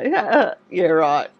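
mock-agreeable "yeah, right" with a chuckle; retold smiling disbelief, lightly laughed; smiling dismissal. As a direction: brush off a compliment with a little laugh. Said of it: "I'd smile and go".

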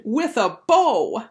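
loud, drawn-out, high "bow"; slow, emphatic exclamation; exclaimed. A narrator's reading. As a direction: stretch it out insistently. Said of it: "Bradley exclaimed".

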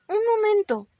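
high, loud plea; little rabbit pleading to be spared. Acted. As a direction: beg urgently for a moment's reprieve. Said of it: "suplicó Ramón".

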